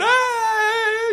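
high, drawn-out imitation wail; hammed-up kid's crying noise; mock wailing cry. Imitating the slammed kid weeping, mock wail. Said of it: "he's crying and he's weeping".

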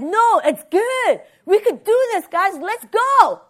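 high, eager pep-talk cry; rally them with rising excitement. Retold or imitated. Two spies urging the people onward, rallying.

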